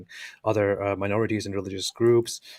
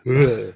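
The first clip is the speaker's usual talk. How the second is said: loud "uh" grunt; weird letter sound; 2 semitones higher, 9 dB louder than usual. Shown as a demonstration. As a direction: push out the odd vowel sound loudly. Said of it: "is this weird, uh, sound".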